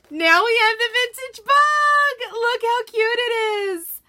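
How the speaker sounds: high, loud, squealing with delight; real excited squeal over a new transfer. Real, not acted. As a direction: shriek it like you just opened a present. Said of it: "I am so excited".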